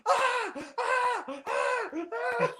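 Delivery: cracking up uncontrollably in the theater; losing it laughing. Retold or imitated. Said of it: "Just losing it".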